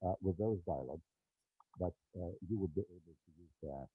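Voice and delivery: muffled through cupped hands; hands over the mic. Real, not acted. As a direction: speak with hands covering the microphone. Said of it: "I'm covering the microphone with my hands".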